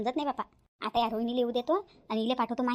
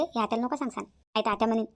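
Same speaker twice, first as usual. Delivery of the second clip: ordinary delivery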